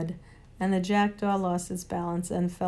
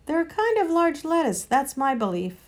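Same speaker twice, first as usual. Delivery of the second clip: rabbit character; 7 semitones higher, 4 dB louder than usual. A narrator's reading. Rabbit speaks up in story.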